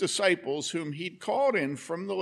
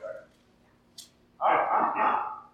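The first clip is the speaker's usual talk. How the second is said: ordinary delivery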